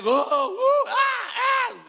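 high shouted cheer, imitating fans; mock crowd cheer. Preacher imitating the crowd's reaction, high mock cheering. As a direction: holler like an excited crowd.

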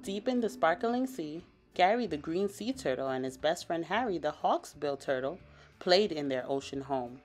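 ordinary delivery, nothing marked

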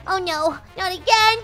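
ordinary talk, nothing marked

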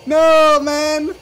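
high, urgent cry of disbelief; dismayed hype. Real, not acted. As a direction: plead at the screen as it slips away. Watching the player miss the ending.

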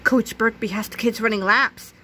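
plain reading voice

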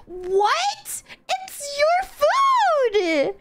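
high, stretched wail of protest; hammed-up incredulous whine; outraged disbelief. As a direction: protest in a high, exasperated cry. Granny turns down the delivery.

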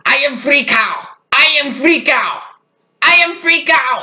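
put-on high, slow, frantic Russian accent; hammed-up Russian mom panicking, drawn out; mom's Russian accent. Russian mom storming into the messy room, frantic Russian accent. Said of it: "with her accent".

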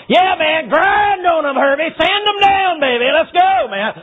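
high, loud whooping encouragement; excited cheering God. Retold or imitated. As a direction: cheer him on like a hype man. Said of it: "God's up there going".

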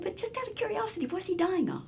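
very high, soft, quick question; mock-innocent query. Voice actor presses producers about the dying character, mock-innocent. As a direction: ask it in a tiny high voice.

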